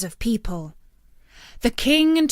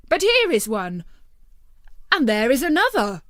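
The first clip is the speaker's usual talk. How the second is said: exclaiming; 5 semitones higher than usual. A narrator's reading. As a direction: point out each door with excitement. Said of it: "they all exclaimed".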